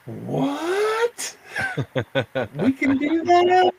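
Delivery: high-pitched, giddy disbelief; astonished squeal. Retold or imitated. Guest retelling his reaction to a show invitation, astonished squeal. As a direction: squeal it through a laugh.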